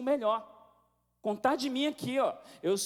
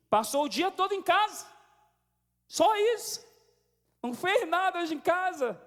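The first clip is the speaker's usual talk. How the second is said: high, loud mock outrage; hammed-up accusing complaint, pitched way up; acted scolding; 10 semitones higher, 4 dB louder than usual. Spouse arriving home and criticizing, acted angry scolding.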